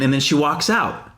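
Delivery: ordinary talk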